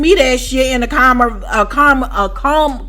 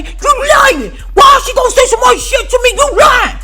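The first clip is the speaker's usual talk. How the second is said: high, screamed accusations; imitated yelling; 12 semitones higher, 4 dB louder than usual. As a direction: screech it as the cellmate calling someone a liar. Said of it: "every time this bitch yell".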